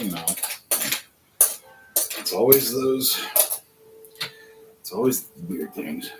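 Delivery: ordinary talk, nothing marked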